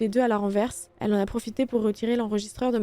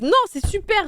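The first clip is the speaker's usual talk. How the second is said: sarcastic; 10 semitones higher, 6 dB louder than usual. Acted. Sarcastic rival mocks the heroine.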